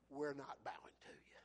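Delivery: very soft, low, near-whispered refusal; quiet defiance. Acted. Three men refuse to bow to the king, hushed defiance. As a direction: let it fall almost to a whisper.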